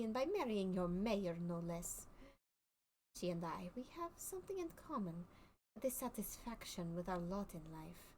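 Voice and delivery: put-on lightly French-accented circus showman. Circus man to the player, explaining his put-on accent in light French accent. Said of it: "I can do French".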